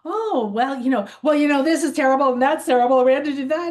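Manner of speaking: mock whiny complainer. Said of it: "I'm like".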